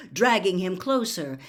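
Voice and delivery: ordinary delivery, nothing marked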